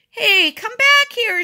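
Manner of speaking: very high, loud shout; urgent high-pitched yell. A narrator's reading. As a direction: holler after a runaway dog. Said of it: "shouted Robert".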